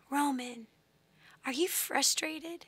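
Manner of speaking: slow, hushed tender asking; soft voice, rising gently at the end; gentle soft question. Retold or imitated. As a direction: coax a small child softly. Mother gently asks her upset son.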